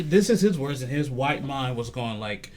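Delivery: normal talking voice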